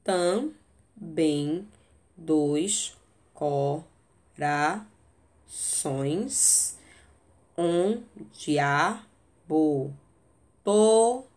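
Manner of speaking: slow, syllable by syllable; deliberate scansion with long pauses; counting syllables. Shown as a demonstration. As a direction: spell out the meter, pausing after each chunk. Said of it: "Por exemplo, vamos pegar esse aqui".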